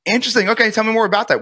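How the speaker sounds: lifted, inquisitive sales tone; curious interest. Shown as a demonstration. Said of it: "Curiosity tone".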